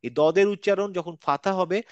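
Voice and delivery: ordinary delivery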